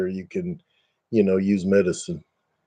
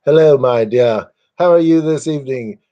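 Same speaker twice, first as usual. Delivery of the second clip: higher, mock snooty English gent; snooty English accent; 6 semitones higher, 3 dB louder than usual. Shown as a demonstration. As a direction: ham up a posh English guy. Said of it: "snooty English guys".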